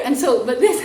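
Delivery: ordinary talk, nothing marked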